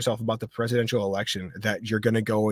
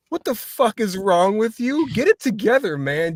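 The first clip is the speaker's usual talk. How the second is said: higher, mock-scolding rant at a mopey buddy; exasperated friend; 8 semitones higher than usual. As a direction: snap your friend out of it, half-joking. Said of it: "the dude looks at him and goes".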